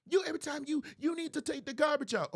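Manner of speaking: high, insistent mock scolding; aggressive nagging. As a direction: press the accusation like an annoyed wife. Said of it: "you too aggressive".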